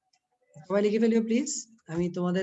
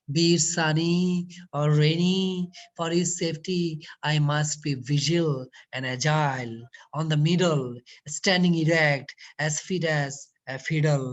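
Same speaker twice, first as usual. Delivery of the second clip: slow, deliberate textbook reading; reading the passage aloud; 6 semitones lower, much slower than usual. Acted. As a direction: read each phrase out carefully, as for a class. Reads the English passage aloud.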